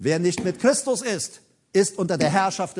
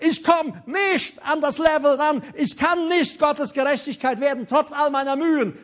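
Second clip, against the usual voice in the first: high, raised preacher's cry; impassioned outcry; 7 semitones higher than usual. Acted. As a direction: act out Paul's desperate admission. Paul cries out his inability.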